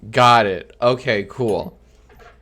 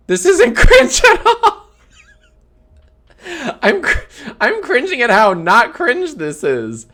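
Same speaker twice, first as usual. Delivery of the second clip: real high-pitched laughing outburst; laughing incredulously; 12 semitones higher, 6 dB louder than usual. Real, not acted. Streamer reacting to supposed cringe, laughing incredulously. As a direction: shout it through helpless laughter.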